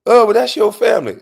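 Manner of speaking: mock the mother's protest up high; voicing his mom. Mother's retort in retold argument.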